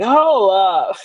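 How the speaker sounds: sharp, loud hold up. Retold or imitated. Stopping the relative's sad news, a loud hold up. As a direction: cut the gloom off loudly. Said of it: "I'm like".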